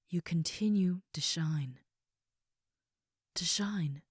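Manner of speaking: tender breathy whisper of praise. Acted. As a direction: whisper it gently, close to the ear. Tender words whispered to listener.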